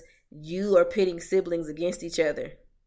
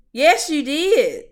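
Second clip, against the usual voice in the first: slow, loud and pointed, pitched up; emphatic retort; 9 semitones higher, 9 dB louder, much slower than usual. Real, not acted. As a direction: spell it out like a final verdict. Retorting to parents' feigned innocence.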